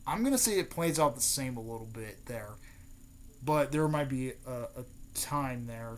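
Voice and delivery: ordinary talk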